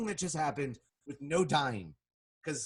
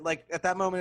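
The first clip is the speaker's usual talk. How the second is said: usual speaking voice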